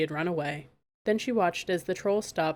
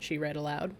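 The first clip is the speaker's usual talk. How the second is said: ordinary delivery, nothing marked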